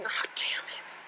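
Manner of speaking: whispered curse under breath; muttered whisper. Real, not acted. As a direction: whisper it to yourself, dismayed. Remembering evening class.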